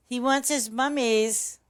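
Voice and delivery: put-on high, loud, slow cooing baby voice; baby talk. Streamer to her meowing cat, sing-song baby talk. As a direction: coo it like talking to a baby.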